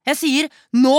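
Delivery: loud, emphatic protest; fed-up outburst. Acted. Child to mother, enough is enough, loud and fed up. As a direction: put your foot down, loudly.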